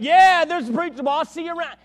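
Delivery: loud, high jeer, imitating a bully; heckling shout. Man yelling after preacher on his way out the door, jeering. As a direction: shout the taunt after someone leaving.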